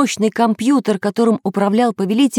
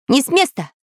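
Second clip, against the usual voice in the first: higher-pitched threatening order; 6 semitones higher than usual. A narrator's reading. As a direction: freeze him with a command. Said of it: "угрожающим голосом". (угрожающий приказ)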